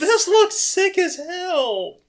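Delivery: high, loud, drawn-out delight; real excitement. Real, not acted. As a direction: gush slowly at high pitch, thrilled. Thrilled by the new ship weapons.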